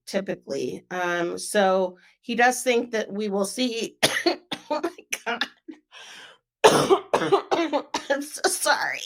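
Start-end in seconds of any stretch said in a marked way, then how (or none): 4.0-7.8 choked out between coughs, higher pitch; exasperated, struggling to talk through a cough; coughing fit. Real, not acted. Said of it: "I don't know why I'm coughing now". 8.1-9.0 higher, half-laughing after a coughing fit; laughing apology. Real, not acted.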